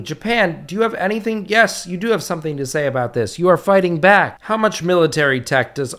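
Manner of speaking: usual speaking voice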